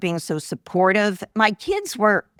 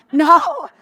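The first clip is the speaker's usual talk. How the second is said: emphatic retold no; 9 semitones higher, 6 dB louder than usual. Retelling reply to technician.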